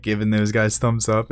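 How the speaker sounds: ordinary talk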